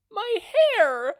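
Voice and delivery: very high, loud wail of loss; high shrieking wail; anguished exclamation. A narrator's reading. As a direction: shriek it as if the world ended. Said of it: "Slice exclaimed".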